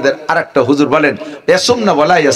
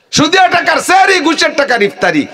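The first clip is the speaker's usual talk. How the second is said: preaching shout; 11 semitones higher than usual. Acted. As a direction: hammer the charge home to the crowd at full voice. Repeats line, then shouts it.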